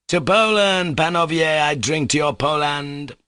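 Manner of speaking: high, ringing toast to Poland; rousing toast. A narrator's reading. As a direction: raise the glass and cry it out. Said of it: "cried Mitya".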